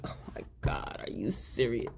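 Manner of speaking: unvoiced, stunned whisper; whispered disbelief. Vlogger reacting to Sabrina's apology, whispered disbelief. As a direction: mouth it in disbelief, hushed. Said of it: "I'm like".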